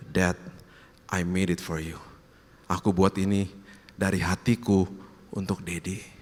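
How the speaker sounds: low, soft, halting recollection; tender, heartfelt. Retold or imitated. Father recalling his child's words of love, tender. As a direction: let each phrase land gently, fading at the end.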